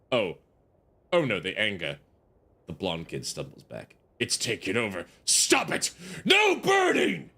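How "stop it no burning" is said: drawn-out high yell; desperate slowed shout. Acted. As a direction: yell at him to stop the fire. Player yelling at burning kid.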